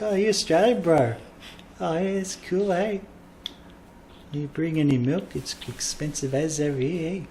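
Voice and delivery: a mock New Zealander, all chill; mock New Zealand accent. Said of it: "starting to sound Canadian".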